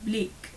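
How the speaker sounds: Australian vowel in bleak. Shown as a demonstration. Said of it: "will become more".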